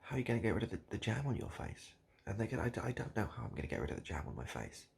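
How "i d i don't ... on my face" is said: ordinary delivery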